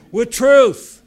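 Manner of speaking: loud, high burst on "with truth"; shouted punchline. Real, not acted. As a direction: hit it hard and high. Punchline of preaching point.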